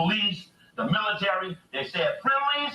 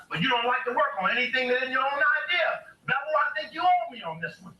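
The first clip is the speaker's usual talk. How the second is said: stern Martin voice; 8 semitones higher than usual. Retold or imitated. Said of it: "He told Bevel".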